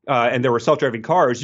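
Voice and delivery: normal talking voice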